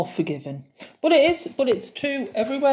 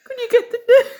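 much higher, louder sing-song Midlands plea; 13 semitones higher, 6 dB louder than usual. Retold or imitated. Patient asking Helen for the nurse in a sing-song Midlands accent. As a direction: plead in a singy Midlands lilt. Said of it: "they're so singy".

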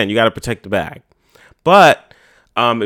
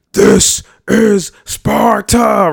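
slow, loud, higher bellowed movie line; Leonidas war cry; 9 semitones higher, 5 dB louder, much slower than usual. Retold or imitated. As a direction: roar it like a Spartan king. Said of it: "he had the signature line".

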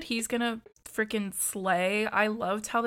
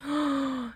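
high, audible intake of breath; sharp reaction gasp; horrified gasp; 6 semitones higher than usual. Real, not acted. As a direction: gasp at something gory. Reacting to the scalping.

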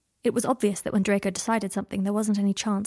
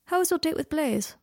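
light, higher-pitched casual question; casually light; 8 semitones higher than usual. A narrator's reading. Draco asks Harry about his date, lightly. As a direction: ask it lightly, as if it hardly matters. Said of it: "he asked lightly".

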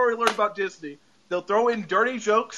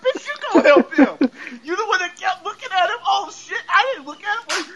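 high-pitched comic indignation, laughing at first; incredulous outrage; 10 semitones higher than usual. Retold or imitated. Viewer telling Wanda to go help him herself, high mock outrage. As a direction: talk back at the screen, voice pitched way up.

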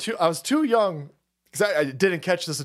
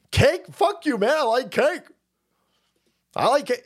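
defiant; 8 semitones higher than usual. Real, not acted. Answering chat mocking cake, high defiant outburst. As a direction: push back hard at chat.

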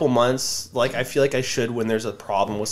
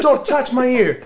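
high, mock-whiny protest; pitched-up comic refusal; exaggerated protest; 10 semitones higher, 4 dB louder than usual. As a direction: squeak it out in mock defence. His own warning, exaggerated.

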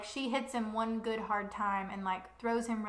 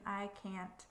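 a softer, breaking breath; 8 dB quieter than usual. A narrator's reading. Nesta breaking down over wounded Cassian, breathing softly. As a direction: let the words crack and fade. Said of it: "voice breaking".

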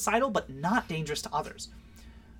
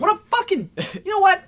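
loud, high frustrated yell; sudden exasperated shout; exasperated outburst; 7 dB louder than usual. Real, not acted. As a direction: blurt it out in disbelief.

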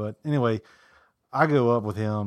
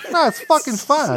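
much higher, louder mock Boston voice; loud Boston brush-off; 7 dB louder than usual. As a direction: shrug it off like a brash Bostonian. Brian dismissing worry.

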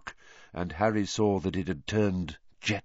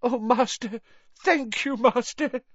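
high, desperate gratitude; grovelling whine. A narrator's reading. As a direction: whimper thanks. Wormtail grovelling thanks.

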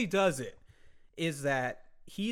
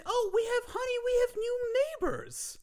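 high sweet mom voice, much higher. Retold or imitated. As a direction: coo it like a doting mom. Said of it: "And they say".

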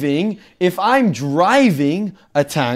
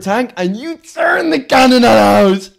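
excited shouting; 8 semitones higher, 5 dB louder than usual. Real, not acted. Excited tank fantasy.

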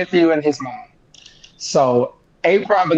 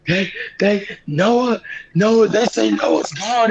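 halting, higher, stumbling over the words; retold distress, breaking off and restarting; barely able to talk; 4 semitones higher, slower than usual. Guest relives phoning friends the terrible news, halting and higher. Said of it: "I can't even talk".

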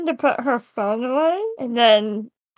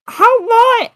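incredulous demand; 9 semitones higher, 5 dB louder than usual. Acted. Player voicing demand to Billy.

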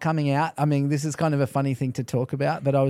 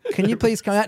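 higher, timidly courteous imitation; mock over-polite request; 6 semitones higher than usual. Canadians asking a demon to leave, mock over-polite. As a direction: ask ever so politely, sending up the manners. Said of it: "they're so polite to demons".

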